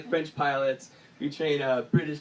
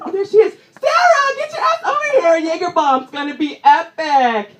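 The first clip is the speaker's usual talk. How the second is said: high, loud call across the party; party shout; 10 semitones higher, 10 dB louder than usual. Retold or imitated. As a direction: yell for her to come over. Partygoer calling Sarah.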